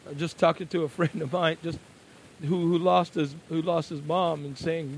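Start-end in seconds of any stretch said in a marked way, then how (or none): none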